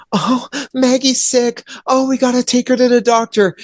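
high, plaintive put-on voice; mock worried wife. Imitating wife fretting over invisible Maggie, mock worry. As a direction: whimper it in mocking, high-pitched concern.

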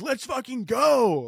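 drawn-out, high mock cheer; high slow holler without extra volume; hyped rallying cry. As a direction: pretend to rally someone, stretched and high. Acted rallying cry for any emergency.

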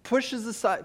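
ordinary talk, nothing marked

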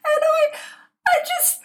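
high giggling through the words; can't stop laughing at the absurdity. Real, not acted. Mother laughing at the old man's reveal. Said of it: "it's so funny".

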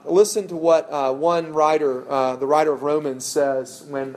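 ordinary talk, nothing marked

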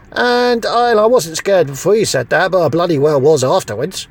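normal talking voice

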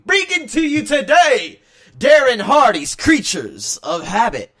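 high, slow, loud announcer voice; announcer intro. Acted. Podcaster introducing the book to listeners in a big slow announcer voice. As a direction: present the book like a radio host.

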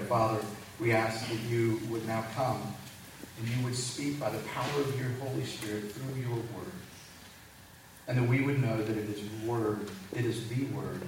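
soft, hushed prayer. Acted. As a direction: pray quietly to the Father. Pastor leads prayer.

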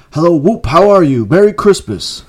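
high, loud and jubilant; exuberant greeting. A narrator's reading. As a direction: call it out with bursting cheer. Scrooge joyfully calls out greetings.

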